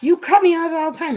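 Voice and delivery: high, mock-outraged protest; indignant retort. Real, not acted. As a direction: push back at him playfully. Teasing quarrel with husband.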